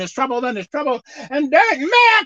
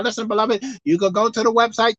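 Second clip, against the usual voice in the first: normal talking voice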